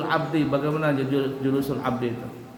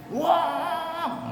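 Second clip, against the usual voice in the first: mock wah. Mocking teasing friends.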